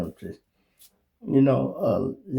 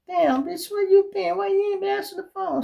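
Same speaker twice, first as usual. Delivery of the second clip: high, loud, fast scolding; high-pitched mock scolding; retold yelling; 4 dB louder than usual. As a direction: yell down the phone at her. Scolding girl on phone.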